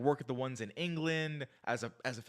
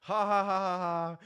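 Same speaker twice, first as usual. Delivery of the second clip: loud, high-pitched imitated laughter; mock laugh; 6 semitones higher, 6 dB louder than usual. Acting out the woman's reply to banter, mock laughing. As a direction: fake an amused ha-ha, pitched up and loud.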